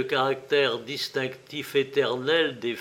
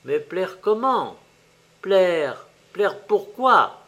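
raised, insistent, obstinate demand; stubborn questioning; 5 semitones higher, 5 dB louder, slower than usual. A narrator's reading. Little shopkeeper questions the fairy's gift, stubbornly. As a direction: press each question slowly and stubbornly, pitched up. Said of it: "demanda opiniâtrement le petit boutiquier".

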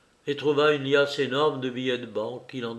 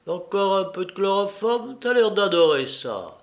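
higher-pitched, playful taunt; teasing; 6 semitones higher, 5 dB louder than usual. Acted. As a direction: offer it like a treat, mockingly. Lupin teases captive while drugging him. (taquinerie moqueuse)

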